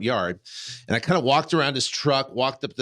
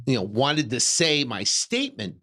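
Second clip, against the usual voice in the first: ordinary talk, nothing marked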